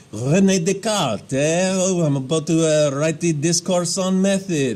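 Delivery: lightly done fake French accent; bad French accent. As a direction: put on a cartoon Frenchman voice, badly. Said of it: "I can't do a French accent".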